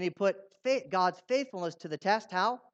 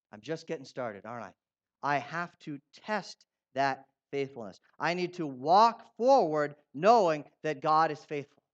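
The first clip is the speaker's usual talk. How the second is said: ordinary talk, nothing marked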